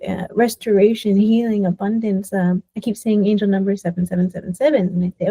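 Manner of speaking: normal talking voice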